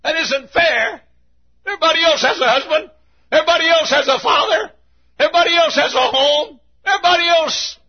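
high-pitched, rapid protest; acted high-pitched grievance; shallow complaining back. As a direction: complain it high and fast, item after item. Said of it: "shallow answers back and says".

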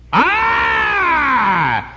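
sudden high yelled boast; mocking the frog's shout; proud shout. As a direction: blurt it out, high and loud. Preacher repeats the frog's cry.

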